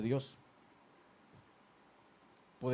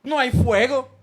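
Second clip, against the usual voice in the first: imitated complaint; 8 semitones higher, 8 dB louder than usual. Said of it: "por eso decimos".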